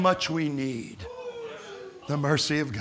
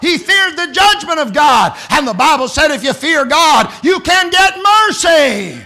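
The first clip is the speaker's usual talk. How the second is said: raised, ringing preacher's shout; preaching; 10 semitones higher, 8 dB louder than usual. Acted. Preacher shouting to the camp meeting. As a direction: preach it out to the congregation.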